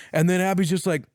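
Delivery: ordinary delivery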